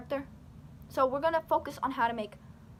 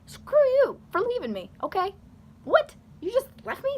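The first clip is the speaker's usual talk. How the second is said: high, loud mock-angry retort; indignant outburst; 10 semitones higher, 7 dB louder than usual. Left-behind friend lashing out at the one who walked off, indignant. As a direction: act out an abandoned friend blowing up. Voices an abandoned best friend.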